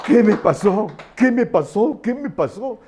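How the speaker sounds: high, repeated astonished question; bewildered. Acted. Brother leaving the ambulance suddenly well, bewildered amazement.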